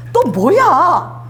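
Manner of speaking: drawn-out, exasperated "또 뭐야?" as a weary father; annoyed God voice. Acted. Preacher voicing God, fed up with Moses' requests, slow exasperated drawl. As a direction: stretch it slowly like a fed-up father. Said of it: "딱 해보세요".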